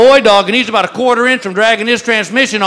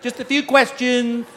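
perky clerk; 5 semitones higher than usual. Retold or imitated. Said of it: "a little girl behind the counter goes".

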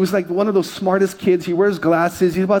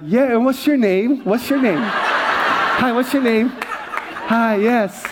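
higher, sweetly eager greeting; mock-gracious, pitched-up hello; flattered celebrity; 5 semitones higher, much slower than usual. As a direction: play suddenly gracious to a fan. Acts flattered reply to fan.

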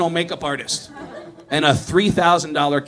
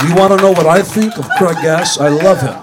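lightly mock Gene, louder; pretending to be Gene; 3 dB louder than usual. Comic answers as Gene to the camera guy, mildly louder. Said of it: "pushed him out of the way and went".

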